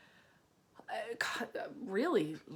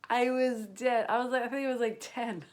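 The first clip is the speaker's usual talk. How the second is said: louder, higher excited retelling; laughing recall, much louder; 4 semitones higher, 7 dB louder than usual. Real, not acted. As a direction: burst out with the memory.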